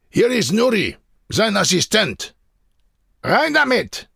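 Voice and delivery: high-pitched shouted announcement; calling out. A narrator's reading. Men outside hand over the assistant, calling out. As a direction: call it in as if from outside. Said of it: "von draußen der Ruf".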